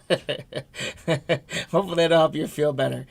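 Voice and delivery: laughing awkwardly through a get-well wish; nervous giggle while wishing him better. Real, not acted. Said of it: "I don't know why I'm laughing about that".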